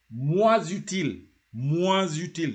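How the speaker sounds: MOINS joined to UTILE with a z sound; liaison. Shown as a demonstration. Teacher models MOINS UTILE for learners, liaison. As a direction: link MOINS into UTILE through a z. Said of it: "You have to say moins utile".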